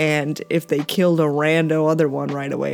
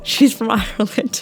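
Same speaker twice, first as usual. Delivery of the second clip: higher, laughing through a second go at the Irish accent; laughing retry of the accent; 5 semitones higher than usual. Shown as a demonstration. Reviewer laughing off her failed Irish accent. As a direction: give up on the accent mid-laugh. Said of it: "Can't do an accent".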